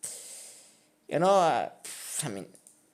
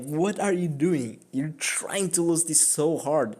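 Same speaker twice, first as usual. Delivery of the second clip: imitating incredulous opponent; 5 semitones higher than usual. Opponent to player who threw the game, acted incredulity. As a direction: act out the opponent's baffled scolding. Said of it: "He was also like".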